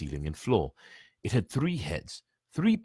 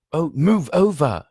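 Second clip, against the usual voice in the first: louder, slow impatient snarl; a touch of a snarl, slow and louder; 8 semitones higher, 8 dB louder than usual. A narrator's reading. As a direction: shove past them with irritation. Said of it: "Hermione snarled".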